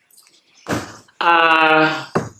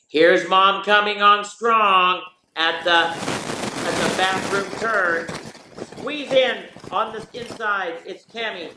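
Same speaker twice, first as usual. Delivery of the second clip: raised, excited play-by-play call; race announcer; 5 semitones higher, slower than usual. Acted. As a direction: call the start like a horse race. Mock horse-race call of family morning.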